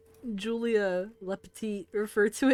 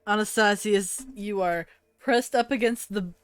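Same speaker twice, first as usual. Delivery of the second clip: game master narration; 4 dB louder than usual. Acted. Game master sets the scene.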